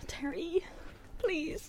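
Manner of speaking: very quiet, desperate plea; tiny, fearful entreaty; desperate pleading. Real, not acted. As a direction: beg under your breath. Begging contestant to answer right.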